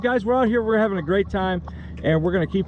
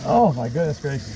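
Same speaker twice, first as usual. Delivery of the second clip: normal talking voice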